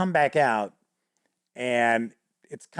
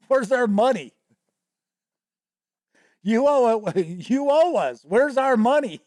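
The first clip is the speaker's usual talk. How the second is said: high-pitched, insistent creditor goblins; demanding goblins; 8 semitones higher than usual. Retold or imitated. Goblins confronting a supposed thief over money, demanding.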